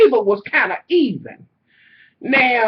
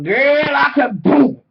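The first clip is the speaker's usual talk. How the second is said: imitating the woman; 5 semitones higher, 4 dB louder, slower than usual. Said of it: "when she went".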